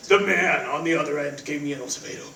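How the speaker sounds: low hushed brooding killer voice; assassin's voice. Acted. [name] tells roommate Cody about the call in his assassin voice, low and hushed. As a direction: mutter it like a grim movie assassin. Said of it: "it's my assassin's voice".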